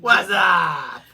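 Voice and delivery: loud, high, barked greeting; mock shout. Friend playing the cop with a loud, high mock shout. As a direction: yell it like a pumped-up cop.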